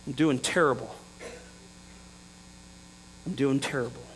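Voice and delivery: low, quiet, defeated reply; glum answer. Retold or imitated. Disciples answer Jesus after a fruitless night, glum and quiet.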